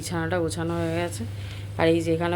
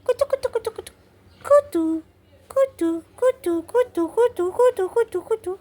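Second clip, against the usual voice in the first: very high squeaky cooing; tiny high-pitched baby-talk babble; tickle-talk; 14 semitones higher than usual. Pretend. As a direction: chant it high, like tickling a puppy. Cooing nonsense at the dog.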